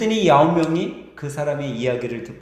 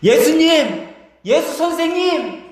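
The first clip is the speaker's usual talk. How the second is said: high, stretched-out calls for attention; shouting from afar; 11 semitones higher, 5 dB louder, slower than usual. Acted. Lepers calling to Jesus over a noisy crowd, pleading shouts. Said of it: "그들은 이제 소리 지르기 시작해요".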